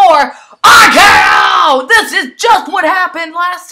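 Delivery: excited shout. Real, not acted. Finisher move hits.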